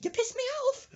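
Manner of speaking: very high falsetto outburst; squealed mock-angry parting shot; fuming old woman. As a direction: imitate an irate granny up high. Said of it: "she pokes her head back in".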